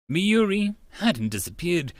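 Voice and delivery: ordinary talk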